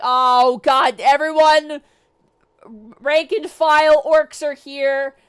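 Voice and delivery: shouted alarm. Retold or imitated. Sugan as orcs fall onto planet, shouting alarm. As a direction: call out to all the troops.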